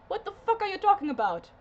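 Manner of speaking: high, fast incredulous challenge; exasperated outburst. Acted. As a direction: fire it off in disbelief. Acted outburst at Anya.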